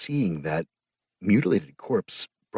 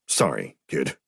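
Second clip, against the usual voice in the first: a lower, clipped captain's apology; gruff captain's sorry; 4 semitones lower, slower than usual. A narrator's reading. Captain apologising to the kid, lower voice. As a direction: apologise briskly, voice dropped lower.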